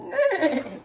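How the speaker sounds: high, loud whinny; whinny like a horse; goose's neigh. A narrator's reading. Teacher shows the goose going neigh, high whinny.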